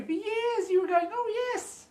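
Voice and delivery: very high, put-on self-satisfied voice; mocking imitation. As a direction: mimic them in a squeaky high pitch. Mocking the false vegetarian.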